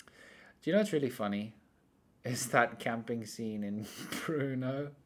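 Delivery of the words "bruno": normal talking voice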